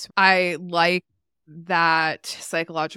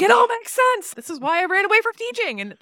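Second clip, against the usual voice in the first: much higher and louder, bursting with excitement; excited outburst; 8 dB louder than usual. Retold or imitated. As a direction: blurt it out like a sudden revelation. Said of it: "I got so excited".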